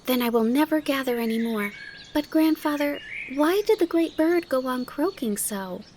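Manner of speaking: put-on high little-girl voice, curious; high-pitched keen questioning; eager inquiry. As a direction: ask grandfather eagerly, like a child. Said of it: "in an eager tone of inquiry".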